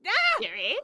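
Rumpelstiltskin voice. Retold or imitated. Imitating Rumpelstiltskin catchphrase.

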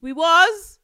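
hushed "okay" then loud, high "we was"; mock their words in disbelief; sceptical side-eye. Hearing a date say we was, sceptical mock. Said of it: "I'm like".

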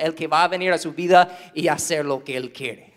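normal talking voice

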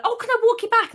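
high, loud mock-caring offer; mock helpful stranger. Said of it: "somebody will pass you and be like".

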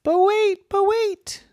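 a tiny mouse trying to be heard; Roy the mouse begging to be heard, squeaky high; little mouse pleading. Acted. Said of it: "And Roy said".

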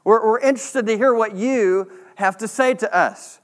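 imitating eager churchgoers; eager villagers. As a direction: sound like a crowd keen to hear him. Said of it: "everybody's like".

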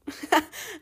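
short high laugh. Real, not acted. Laughs amid the rant.

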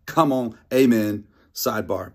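ordinary talk, nothing marked

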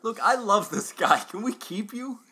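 gushing. Acted. Gushing over the creepy agent.

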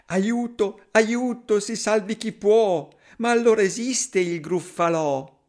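terrified mouse. Acted. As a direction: squeak it out in mock terror. Mouse meets the monster.

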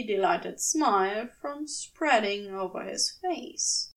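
plain reading voice

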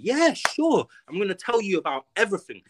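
high, fast, sarcastic gullible yes; mocking eager voice. Mocking a gang member who happily agrees to talk. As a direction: send up the naive gang member, squeaky and quick.

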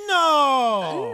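high, loud, long-drawn mock wail; mock outcry. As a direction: cry out in hammed-up dismay. Mock outcry at cancelled visit.

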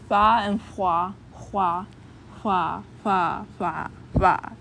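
fa and hua said slowly, hua breathy from the back; fa and hua demo. Shown as a demonstration. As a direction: repeat hua with a back fricative. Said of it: "You hear it?".

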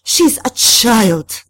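high, loud, drawn-out protest; shrill screamed objection. A narrator's reading. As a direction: scream it, stretching each word. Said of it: "the madame screamed".

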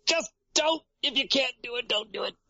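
high, squeezed mock plea; cringing, high-pitched mock exasperation; exasperated plea. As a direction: beg in a high, pained little voice. Acted cringing plea at bad accents.

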